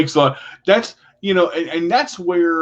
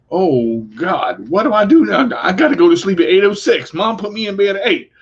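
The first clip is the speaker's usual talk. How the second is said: higher, louder, hammed-up fretting; mock teenage panic; 4 semitones higher, 4 dB louder than usual. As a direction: play a teen suddenly lost without mom. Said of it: "first moment you realize".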